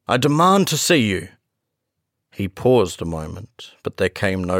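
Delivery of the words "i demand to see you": raised, higher commanding voice; firm, loud demand. A narrator's reading. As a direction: demand it loudly through the door. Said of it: "cried Utterson with a loud voice".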